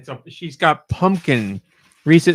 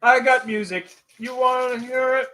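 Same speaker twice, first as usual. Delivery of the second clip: playful high voice; 11 semitones higher, slower than usual. Acted. Teasing the music segment.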